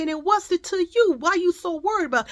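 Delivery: high, loud challenge; retold indignation. Mother telling a stranger off, retold indignantly. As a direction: challenge her, acting out the argument.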